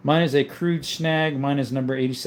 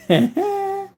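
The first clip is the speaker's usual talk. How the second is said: high-pitched burst of laughter. Real, not acted. Streamer amused by a chat message, laughing high. Laughs after misreading a comment.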